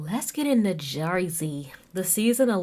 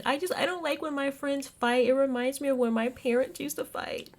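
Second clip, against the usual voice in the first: high-pitched imitation of crying; mock tearful; 8 semitones higher than usual. Tiffany explaining why she cries, mock tearful. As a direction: put on a high, weepy little plea. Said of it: "she starts crying".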